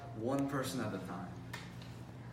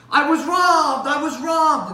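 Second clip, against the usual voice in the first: high, loud cry of alarm; panicked clerk; 12 semitones higher, 15 dB louder, slower than usual. Acted. Robbed clerk flagging down a cop, panicked high yell.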